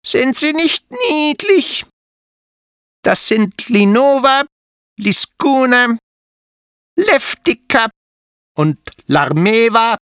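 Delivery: kindly spider mother inviting them in; Ludovica the spider. A narrator's reading. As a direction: welcome the children like a proud hostess. Spider proudly names her babies.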